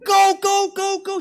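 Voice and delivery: very high, loud yell; frantic shouting. Acted. Husband urging his wife to get downstairs, frantic shouting. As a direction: holler at her to move.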